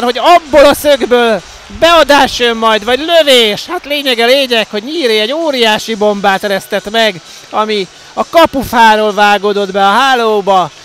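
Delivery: loud, high, breathless goal recap; excited hungarian sports commentary. Real, not acted. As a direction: keep riding the goal high. Commentary right after the goal. (izgatott magyar sportkommentár)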